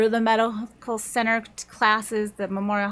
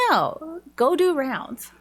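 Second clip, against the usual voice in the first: higher-pitched, mildly cross retold order; mock stern refusal; 4 semitones higher, slower than usual. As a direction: imitate a teacher firmly refusing, without shouting. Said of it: "would have been getting mad at them".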